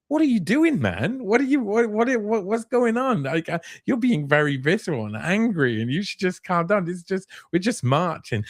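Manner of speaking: high, incredulous mock pleading; retold own protest. Journalist pleading with the angry lawyer, high incredulous voice. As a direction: pitch it up, baffled and pleading. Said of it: "And I'm like".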